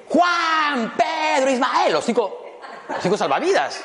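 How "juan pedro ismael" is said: calling out names. Retold or imitated. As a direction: call each name out across the beach. Mom calls the lifeguards. (llamado agudo y fuerte a los salvavidas)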